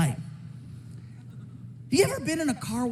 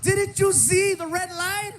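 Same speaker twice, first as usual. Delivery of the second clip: exasperated; 10 semitones higher than usual. Retold or imitated. Passenger asking the driver about the red light, high incredulous whine. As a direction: plead in disbelief at the driver.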